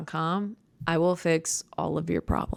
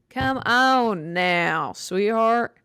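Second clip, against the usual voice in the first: slow, drawn-out put-on voice, louder; mock patronizing scold; 4 semitones higher, 5 dB louder, much slower than usual. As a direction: talk down to them like a condescending aunt. Mock scolding about Anne Frank.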